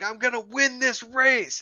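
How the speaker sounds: high-pitched acted resolve; determined driver, retold. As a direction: declare it like a fired-up racer. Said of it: "It's like".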